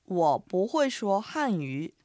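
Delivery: slow, careful Mandarin; slow teaching demo; slow review phrase. Shown as a demonstration. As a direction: repeat slowly in review. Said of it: "おさらいしましょう".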